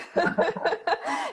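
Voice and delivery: a short high giggle; real amused giggle. Real, not acted. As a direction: laugh along warmly. Host laughs at coffee remark.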